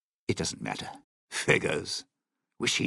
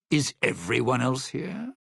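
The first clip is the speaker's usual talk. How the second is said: ordinary delivery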